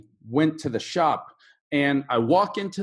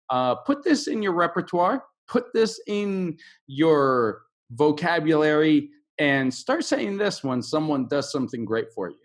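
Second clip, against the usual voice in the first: ordinary talk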